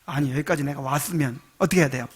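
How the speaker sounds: ordinary talk, nothing marked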